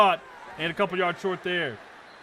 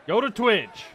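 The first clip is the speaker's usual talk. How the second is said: calling out; 5 semitones higher, 7 dB louder than usual. Real, not acted. Urging viewers to switch platforms.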